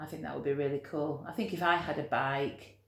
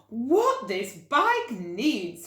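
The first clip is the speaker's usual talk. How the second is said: slow, drawn-out, higher musing voice; storybook lady thinking aloud; Mrs Armitage musing; 7 semitones higher, 8 dB louder, much slower than usual. Acted. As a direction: savour each word as a bright idea.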